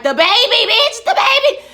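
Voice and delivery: high, loud panicked shriek breaking into a laugh; panicked scream. Retold or imitated. Viewer watching the baby swept away, shrieking in panic. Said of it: "I was like".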